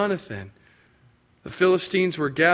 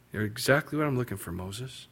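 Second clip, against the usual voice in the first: God's gentle reply; 4 semitones lower, 8 dB quieter than usual. Acted. God answering Moses, low and soft.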